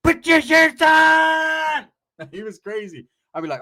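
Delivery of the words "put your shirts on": high, loud, long-drawn holler; yelling out the window. Retold or imitated. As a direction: stretch the last word into a far-carrying yell. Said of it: "he'll yell out the window".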